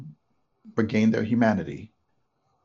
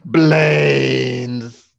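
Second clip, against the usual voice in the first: loud drawn-out zombie moan; 4 semitones higher, 10 dB louder than usual. Shown as a demonstration. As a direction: groan it like a zombie. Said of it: "you have to say it like a zombie".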